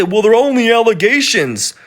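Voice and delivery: lightly mimicking someone brushing it off; mocking a dismissive voice. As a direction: sneer "they're only allegations" in a put-on voice. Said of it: "when you say".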